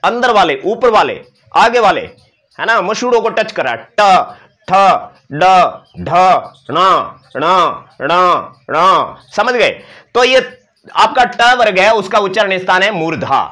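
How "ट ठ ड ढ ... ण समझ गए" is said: ट ठ ड ढ ण spoken one by one, tongue tip curled back; slow teacher demo of ट, ठ, ड, ढ, ण; retroflex series demo. Shown as a demonstration. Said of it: "ट, जीभ है वो".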